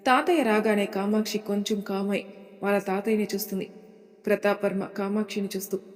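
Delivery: ordinary delivery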